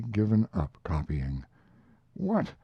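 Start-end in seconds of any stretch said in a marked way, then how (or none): 2.1-2.5 much higher sharp "What!"; startled exclamation. Acted. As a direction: jolt of disbelief. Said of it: "exclaimed I".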